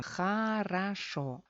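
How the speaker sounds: хорошо as kha-ra-SHO, drawn out slowly; reduced vowels. Shown as a demonstration. Teacher showing how O is reduced, slow reduced pronunciation. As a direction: say хорошо slowly with both unstressed O's turned to A. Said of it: "Sufren reducción".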